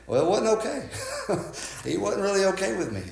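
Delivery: high-pitched, laughing through the words; amused, laughing. Real, not acted. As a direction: chuckle as you admit it, voice jumping up. Laughs recalling the friend's false okay.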